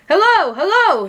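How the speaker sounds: high, loud hello into the phone; firemen answering. A narrator's reading. Firemen answering the phone, high and loud. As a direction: answer the call urgently. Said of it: "they said".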